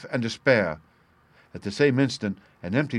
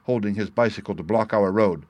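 ordinary delivery, nothing marked

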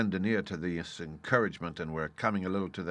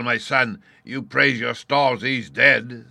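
gruff sailor's speech; old sea dog talking; pirate voice; 4 semitones higher, 11 dB louder, slower than usual. A narrator's reading.

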